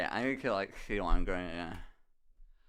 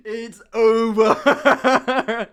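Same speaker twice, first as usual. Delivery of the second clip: very slow line, louder, through laughter; laughing, slow; 4 semitones higher, 9 dB louder, much slower than usual. Real, not acted. Telling viewers the Irish guy sat there, laughing slowly. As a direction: drag it out while laughing.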